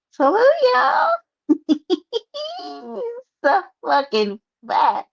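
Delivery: high, cracking laugh through mock tears; laugh-crying. Real, not acted. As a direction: squeal it out half laughing half crying. Laughing-crying bit about herself.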